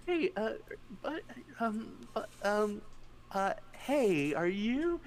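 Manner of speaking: high, halting, awkward voice; hesitant approach. Acted. Torvik approaching a screaming companion, tentative and stammering. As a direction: stammer carefully, unsure how to ask.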